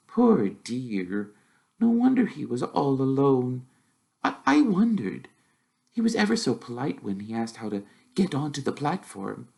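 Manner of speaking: put-on a mother feeling for the lonely boy; gently voiced sorry mum; sympathetic mother.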